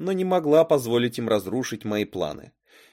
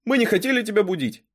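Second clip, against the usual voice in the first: put-on high, quick apologetic boy's voice; 11 semitones higher, 5 dB louder, faster than usual. A narrator's reading. Intruder apologises to the girl he woke, high and hurried. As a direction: excuse yourself in a rush, pitched up.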